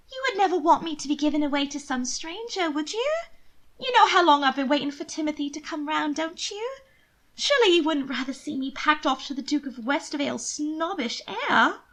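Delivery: high, coaxing plea; pleading sister. Acted. Sister guilting her twin to spare her a marriage, coaxing. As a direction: guilt him into helping.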